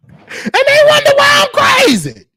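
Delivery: loud cracked yell; exasperated shout. Real, not acted. Comedian yelling to crowd about his crazy upbringing, loud. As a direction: holler it like a wailing complaint.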